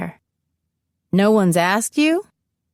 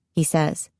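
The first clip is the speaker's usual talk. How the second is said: usual speaking voice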